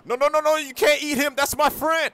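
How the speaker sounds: high, loud panicked voice; a frightened friend begging; high frantic pleading. Acted. Plays friend Crypto.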